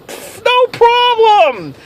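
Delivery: high, slow, exaggerated dismissive pfft; hammed-up carefree shrug-off; mocking scoff. Friends shrug off rule again.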